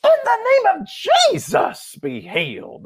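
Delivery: high, loud mock tent preacher, dropping at the end; R W Shambach. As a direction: imitate a wild revival healer. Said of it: "that's the way he talked".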